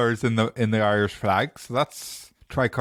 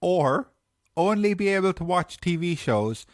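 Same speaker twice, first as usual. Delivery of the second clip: question announcer; 2 semitones higher than usual. Acted. Reading the podcast question, music.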